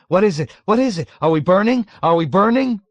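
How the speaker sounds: high, loud frantic questions; panicked crying out. A narrator's reading. Patient on a crutch fearing fire, crying out in panic. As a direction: call out in sheer panic. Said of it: "swiftly in panic, crying".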